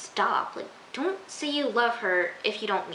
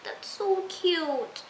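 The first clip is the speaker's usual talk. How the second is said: gushing aww; 8 semitones higher, much slower than usual. Retold or imitated. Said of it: "I was like".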